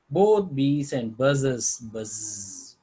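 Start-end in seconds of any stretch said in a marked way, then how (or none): 1.8-2.8 drawn-out buzz; buzzing sound. Shown as a demonstration. Teacher giving an onomatopoeia example, buzzing sound. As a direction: let the buzz linger. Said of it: "Onomatopoeia means when you see this".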